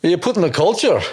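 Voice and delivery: high, rolled Scottish "culture"; a quick go at a Scottish brogue; Scottish accent. Shown as a demonstration. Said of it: "Say it in a Scottish accent".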